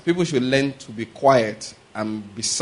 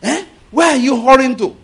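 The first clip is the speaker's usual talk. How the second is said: high, loud indignant scolding; angry driver; 10 semitones higher, 7 dB louder than usual. Acted. Angry driver telling off a trailer on the road, indignant shout. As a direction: berate a stubborn trucker, louder and higher. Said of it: "You are still angry with the trailer".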